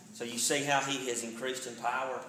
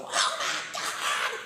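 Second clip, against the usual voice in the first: god-awful scream; 4 semitones higher, 4 dB louder, much slower than usual. Retold or imitated. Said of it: "I heard this god-awful".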